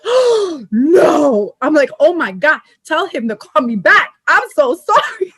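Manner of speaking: hammed-up horrified apology, laughing by the end; mortified shock. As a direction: replay the panicked realisation, half laughing. Retold shock on learning caller's identity.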